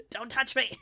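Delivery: giggling mock refusal; laughing. Real, not acted. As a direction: laugh through it. Joking about not wanting hugs.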